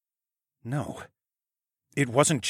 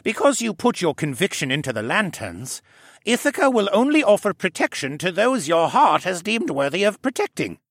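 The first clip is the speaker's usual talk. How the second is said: put-on raised, gentle voice of the old koala scholar; Jenner's voice; 9 semitones higher, 5 dB louder than usual. A narrator's reading. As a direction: speak up as the fussy, kindly koala gentleman. Jenner explains, narrated.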